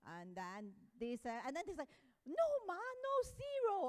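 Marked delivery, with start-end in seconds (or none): 2.2-3.9 high-pitched quick objection; acted protest. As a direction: imitate an insistent "no", voice jumping high. Acted objection quoted from the children.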